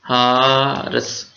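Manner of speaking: Horse stretched slowly and dropping; slow modelled pronunciation. Shown as a demonstration. Teacher modelling horse for the class, slowly. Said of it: "horse को कैसे pronounce करना है?".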